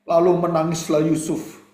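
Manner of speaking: usual speaking voice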